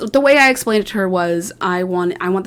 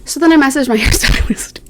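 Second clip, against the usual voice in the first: ordinary talk, nothing marked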